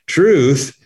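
usual speaking voice

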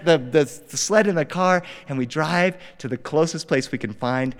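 usual speaking voice

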